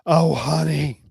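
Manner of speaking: sultry purring endearment. Acted. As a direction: purr it like a tease. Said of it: "Justine purred".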